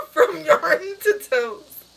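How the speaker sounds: loud, high, drawn-out words through laughter; real giggle fit breaking into the words; breathless laughing. Real, not acted. Marvelling at the silly topics, laughing breathlessly. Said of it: "I can't breathe".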